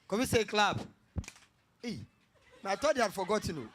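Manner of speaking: ordinary talk, nothing marked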